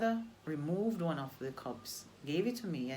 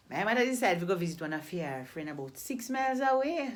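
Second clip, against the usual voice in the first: Jamaican patois; 3 semitones higher, 3 dB louder than usual. Retold or imitated. Retelling her childhood thirst to listeners in Jamaican patois. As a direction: tell it like a Jamaican grandmother in full patois. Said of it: "tell you this same story in the Jamaican patois dialect".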